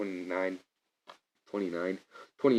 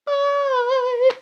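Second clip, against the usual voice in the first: tiny cartoon voice, much higher and louder; squeaky goodbye; 6 dB louder than usual. Voicing the Ranger suits flying away, high squeak.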